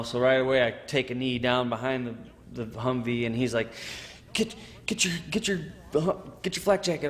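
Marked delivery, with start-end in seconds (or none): 4.3-7.1 high, urgent order from the squad leader. Retold or imitated. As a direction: hurry him out of the jacket, pitched up. Squad leader urging him to strip.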